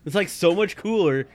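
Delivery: normal talking voice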